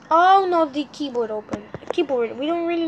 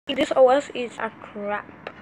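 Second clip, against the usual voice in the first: ordinary talk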